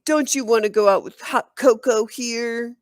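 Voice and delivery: loud sneering tease; mocking. A narrator's reading. Boy mocking the girl in front of the sixth grade. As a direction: make fun of her to the crowd.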